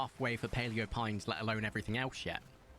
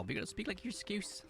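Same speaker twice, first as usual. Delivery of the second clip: attempted Scouse accent mixed with a southern drawl, quieter; 8 semitones higher, 4 dB quieter than usual. Shown as a demonstration. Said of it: "I'm actually trying to combine it".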